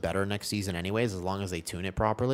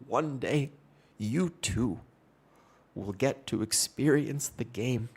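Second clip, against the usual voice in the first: a lightly voiced, slow, solemn mock father; slower than usual. Dad blessing his son about ping, slow mock solemn. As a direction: intone it like a blessing.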